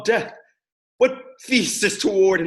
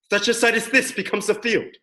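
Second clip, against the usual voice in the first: ordinary delivery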